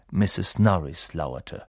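usual speaking voice

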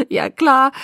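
high, loud, laughing reply; chuckling. A narrator's reading. Jacob still laughing at Bella's scolding, chuckling. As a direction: chuckle through the words. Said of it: "sagte er immer noch glucksend".

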